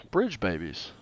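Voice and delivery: ordinary talk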